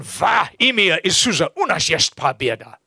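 loud Russian prayer; forceful Russian prayer. Shown as a demonstration. Preacher prays over someone, loud forceful Russian prayer. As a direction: declare it in Russian with strength. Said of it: "just the strength of it".

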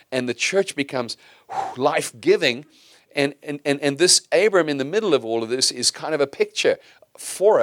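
rising preacher's fervour toward a call; building sermon. Acted. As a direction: pastor urging the church, building preaching.

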